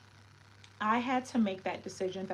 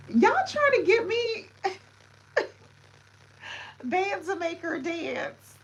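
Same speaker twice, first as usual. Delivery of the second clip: high, loud words through laughter; laughing squeal; 10 semitones higher, 7 dB louder than usual. Real, not acted. Streamer laughing at viewers making it rain.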